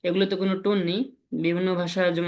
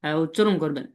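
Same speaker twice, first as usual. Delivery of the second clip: ordinary delivery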